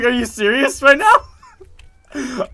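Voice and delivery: loud, high, drawn-out incredulous cry; laughing disbelief. Retold or imitated. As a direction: retell it in disbelief, dragging it out through laughter.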